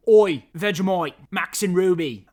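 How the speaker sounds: loud, high mock-Australian shout; hammed-up Aussie accent, badly faked. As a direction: call out in a terrible Australian accent. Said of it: "I can't do a good Australian".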